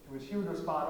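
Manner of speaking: usual speaking voice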